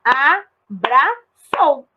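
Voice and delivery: "Abraçou" slowly broken into a... bra... çou; deliberate classroom syllable demo of "Abraçou". Shown as a demonstration. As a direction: keep çou whole in "Abraçou", pausing before it. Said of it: "Eu não separei".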